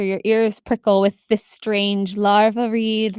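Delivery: ordinary talk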